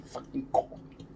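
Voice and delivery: breathless whispered plea. Retold or imitated. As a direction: whisper it desperately. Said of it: "I'm like".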